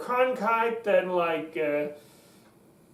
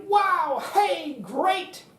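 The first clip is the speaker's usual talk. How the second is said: high, energetic exclamations; pepped-up, excited reaction; 8 semitones higher, much slower than usual. Shown as a demonstration. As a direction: burst out with enthusiasm. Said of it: "Energy can come from excitement".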